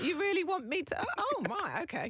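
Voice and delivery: high-pitched, overwhelmed disbelief; disbelieving. Retold or imitated. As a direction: act out amazed self-doubt. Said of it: "real imposter syndrome".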